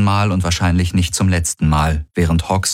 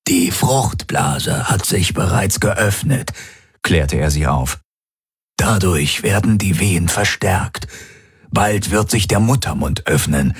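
calm doctor; slower than usual. A narrator's reading. Doctor calming woman in labour.

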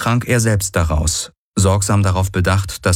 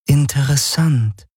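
Schattenhelix voice; 7 semitones higher than usual. Acted. The Schattenhelix comments.

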